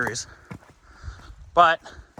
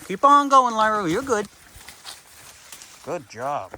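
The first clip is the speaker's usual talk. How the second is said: encouraging the dog; 6 semitones higher than usual. Real, not acted. Encouraging the puppy.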